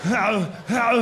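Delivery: two long action-hero groans; roar-groan it like a mock movie warrior; imitated battle grunt. Predator scene grunt imitation.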